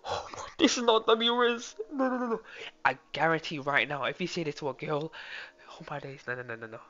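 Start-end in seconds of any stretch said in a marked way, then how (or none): 0.1-2.4 high, pleading no no no; high-pitched horrified protest; high disbelief. Real, not acted. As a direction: squeak out the denial way up high. Reacting to bad pickup line.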